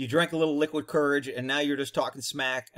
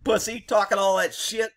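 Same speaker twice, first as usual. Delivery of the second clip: retold yell; 5 semitones higher than usual. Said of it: "I was like".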